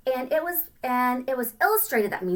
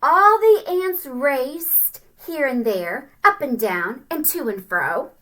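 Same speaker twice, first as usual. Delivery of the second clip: very slow, louder and higher storybook read; slow sing-song read-aloud; 4 semitones higher, 7 dB louder, slower than usual. Acted. Teacher reading the ants' march, slow and sing-song. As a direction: stretch it out for the kids.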